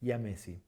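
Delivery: "yamesi" said as ya-MEH-si. Shown as a demonstration. Teacher naming the flamingo, Latin y sound in "yamesi". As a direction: start "yamesi" with an i-glide. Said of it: "la pronunciamos como I".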